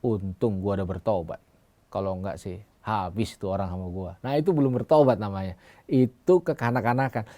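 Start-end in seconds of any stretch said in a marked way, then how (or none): 0.0-4.2 unrepentant braggart. Retold or imitated. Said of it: "Sama seperti orang bilang gini".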